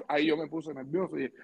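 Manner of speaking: usual speaking voice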